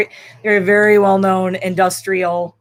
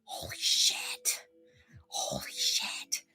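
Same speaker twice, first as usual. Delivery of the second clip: breathless, slow whisper in awe; stunned whisper; 16 dB quieter, much slower than usual. Retold or imitated. Retelling winning the award, stunned whisper. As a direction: whisper it in disbelief. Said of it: "And I'm like".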